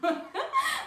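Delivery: a sudden high burst of laughter; loud laugh. Real, not acted. Bursts out laughing at own joke.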